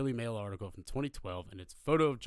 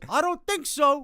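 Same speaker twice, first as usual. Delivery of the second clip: slow, high, loud holler; drawn-out mock scolding from a balcony; shouted disapproval; 10 dB louder, slower than usual. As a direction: imitate a neighbour yelling down. Neighbour shouts answer down.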